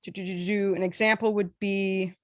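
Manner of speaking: ordinary delivery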